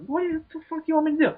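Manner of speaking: high, very fast exasperated rant. Retold or imitated. To the seller who walked away, high fast exasperation. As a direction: fire it off in disbelief.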